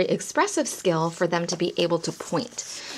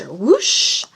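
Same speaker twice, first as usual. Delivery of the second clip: loud whoosh; 8 dB louder than usual. Shown as a demonstration. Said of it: "imitating play sounds".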